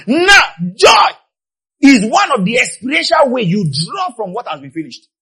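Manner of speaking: high, loud sermon cry; impassioned preaching. Real, not acted. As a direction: proclaim it to the whole congregation. Preaches at full force.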